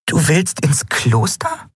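high, slow puzzled question; confused. A narrator's reading. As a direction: draw out the question in disbelief. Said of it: "fragte Nelen verwirrt".

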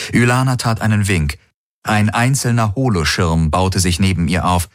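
plain reading voice

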